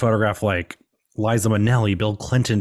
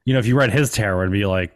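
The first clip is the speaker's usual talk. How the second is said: ordinary delivery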